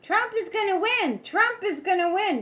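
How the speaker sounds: mock a gloating fan; mocking cheer. Mocking Trump supporters' cheer.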